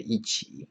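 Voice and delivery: 一 in 一起 said with a falling fourth tone. Shown as a demonstration. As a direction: say 一起 with 一 falling before third-tone 起. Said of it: "you always pronounce fourth tone".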